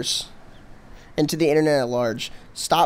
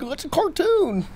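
high, mocking, dopey voice; mock the friend's excitement; mocking impression; 12 semitones higher than usual. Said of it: "your thing where you're like".

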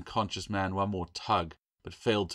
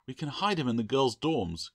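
put-on higher-pitched, stubborn girl; mildly stubborn insistence; 6 semitones higher, 3 dB louder than usual. A narrator's reading. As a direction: dig in and insist. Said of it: "Claire said stubbornly".

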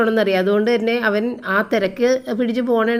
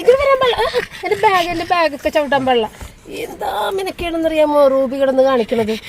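much higher scolding pet talk; talking to the cat; 6 semitones higher than usual. Real, not acted. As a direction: coo and scold the crying cat in a high voice. Scolding crying cat Ruby in car. (പൂച്ചയോട് ഉയർന്ന ശബ്ദത്തിൽ ശാസിക്കുന്നു)

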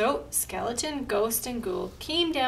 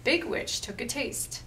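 ordinary delivery, nothing marked

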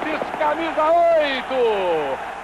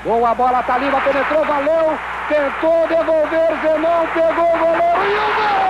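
rising, faster goal call; excited play-by-play; 3 semitones higher, 4 dB louder than usual. Acted. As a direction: build up to the goal shout.

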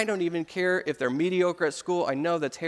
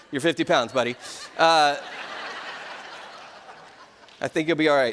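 chuckling through the reassurance to his son; laughing; 2 semitones lower than usual. Real, not acted. Father laughing to his young son about steroids. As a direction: laugh it out as you tell him he's fine.

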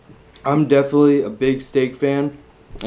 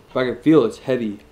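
talking between bites; chewing while talking. Real, not acted. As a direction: mouth full of crispy food. Seen 1: bites chicharron at 3.8s, chews with full mouth while talking.

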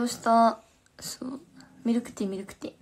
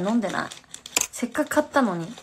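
usual speaking voice

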